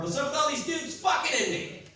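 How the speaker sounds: very high, squeaky put-upon voice; talking bush impression. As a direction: play a whiny park bush. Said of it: "this is my impression of a bush in a public park".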